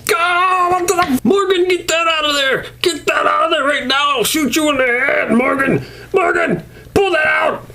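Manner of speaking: high cartoon shriek with a cry; panicked shrieking. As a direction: yell at Morgan to pull it out. Rick yelling at Morgan.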